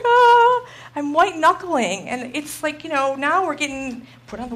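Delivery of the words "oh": laughing then a much higher, louder "Oh"; hammed-up startled gasp; mock shocked gasp. Retelling her shocked reaction.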